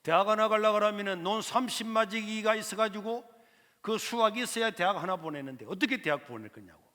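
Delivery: 큰 소리로 퉁명스럽게 말하는 삼촌; 학비를 거절하며 크게 따지는 부자 삼촌; scoffing uncle. Retold or imitated. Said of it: "우리 삼촌이 하시는 말씀이". (조카 대학 학비를 거절하는 삼촌, 크게 따지듯)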